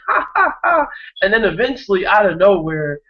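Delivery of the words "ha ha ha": put-on very high baby giggle; imitated toddler laugh. Dad imitating his toddler's laugh, tiny high giggle. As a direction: mimic the little one laughing. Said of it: "is just like".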